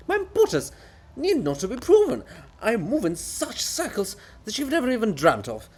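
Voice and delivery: high, loud, self-important rant; haughty author defending his greatness; pompous offended writer. Acted. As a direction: puff up with wounded vanity. Bulgakov voiced, offended grandeur.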